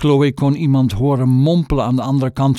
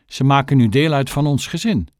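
plain reading voice

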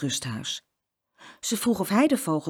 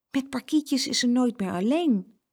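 ordinary delivery

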